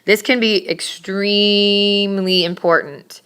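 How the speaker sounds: "extremely" stretched out long; heavy, slow emphasis on "extremely"; stress on extremely. Shown as a demonstration. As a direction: drag out the middle of "extremely" for emphasis. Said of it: "placing emphasis on certain words".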